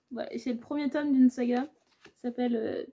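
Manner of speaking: usual speaking voice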